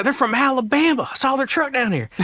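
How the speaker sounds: high mock southern twang; put-on southern accent. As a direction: do a southern hunter reporting to his buddies. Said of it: "like, a southern accent".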